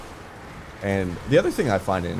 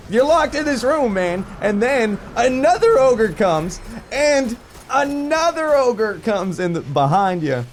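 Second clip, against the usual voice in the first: high, loud dramatic narration; loud high excited buildup; 14 semitones higher, 5 dB louder than usual. Retold or imitated. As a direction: hype up the ogres like a trailer voice. Dramatic retelling of ogres.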